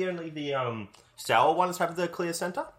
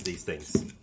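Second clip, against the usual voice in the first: whispering; 9 semitones lower, 7 dB quieter than usual. Real, not acted. Whispered aside after the candy talk.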